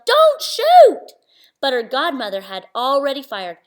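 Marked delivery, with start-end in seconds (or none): 0.1-1.1 shrieked much higher and louder; yelled. Acted. Cindy seeing the godmother draw her gun, panicked yell. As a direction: scream it in panic. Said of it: "and Cindy yelled".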